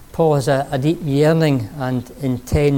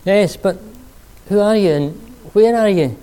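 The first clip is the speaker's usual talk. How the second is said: calling out; 6 semitones higher, 4 dB louder than usual. Acted. Jack calls to unseen voice.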